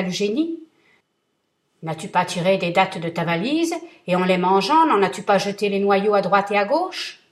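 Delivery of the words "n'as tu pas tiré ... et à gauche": booming, much louder threat; thundering vengeful genie; terrible genie voice. Acted. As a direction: roar it down at him like a giant. Genie accuses merchant.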